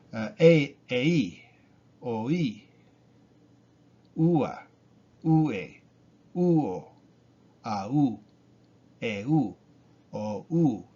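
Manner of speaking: ei, oi, ua, au each split, stress on the i or u; two separate syllables. Shown as a demonstration. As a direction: lean on i and u in ei, ua, eu. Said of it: "You have two different syllables".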